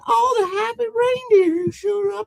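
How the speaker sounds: put-on very high twangy accent; LaFranc. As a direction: babble happily in a twangy falsetto. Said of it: "in his brand new twangy accent".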